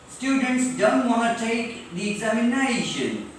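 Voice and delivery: stretched "ZAY", "LAY", "NAY" in ORGANIZATION, POPULATION, EXAMINATION; stress before -tion. Shown as a demonstration. Teacher drilling example sentences, stretched stress before "-tion". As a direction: draw out the vowel before "-tion". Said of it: "जोर से पढ़ना है".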